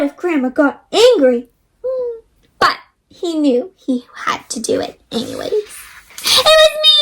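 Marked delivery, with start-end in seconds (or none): 5.5-7.0 put-on high, slow, hesitant child's voice; a nervous kid blurting out the truth; little boy confessing. Finn confesses to Grandma in a high child voice. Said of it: "Finn said".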